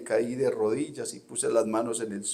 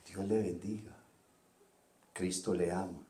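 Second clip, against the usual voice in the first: softer, tender greeting in a lightly voiced sister's tone; sweet gentle voice; 2 semitones lower, 7 dB quieter than usual. Pastor imitates Sister Liliana answering the phone, softer and tender. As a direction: greet the caller sweetly, like a blessing. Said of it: "con una voz dulce".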